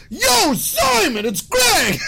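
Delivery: very high, slow, drawn-out call across the airport; retold yell. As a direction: holler to a friend far away. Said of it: "So I just yelled out".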